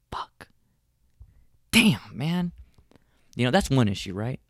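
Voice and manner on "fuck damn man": whispered disappointment. Retold or imitated. Husband rejected by tired wife, whispered letdown. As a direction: whisper then groan of defeat. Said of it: "I'm like".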